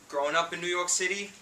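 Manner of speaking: normal talking voice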